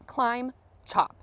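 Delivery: ordinary delivery, nothing marked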